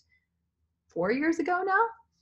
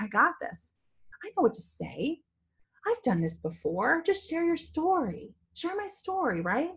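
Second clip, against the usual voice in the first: retold pep talk, climbing higher at the end; self pep talk; 8 semitones higher than usual. Speaker retelling how she psyched herself up to speak, self pep talk. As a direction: reassure yourself lightly, pitch rising.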